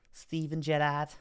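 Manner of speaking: high-pitched mock Liverpool accent; attempted Scouse accent. Shown as a demonstration. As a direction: have a joky go at a Scouse accent. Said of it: "using a Liverpool accent".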